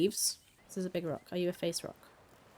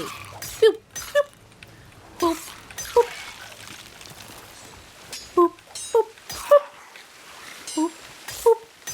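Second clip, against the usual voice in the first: high-pitched boops; 13 semitones higher, much slower than usual. Real, not acted. Noises while digging.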